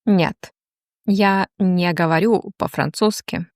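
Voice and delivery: ordinary delivery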